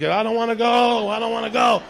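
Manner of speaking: high, loud wailing plea; Apocalypse Now kid. Retold or imitated. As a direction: scream it like the kid in the film. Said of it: "like the girl in Apocalypse Now".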